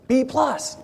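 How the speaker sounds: high, sing-song announcement; announcing the result. Retold or imitated. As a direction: pop it up high like a surprise. Lab tech announces blood type.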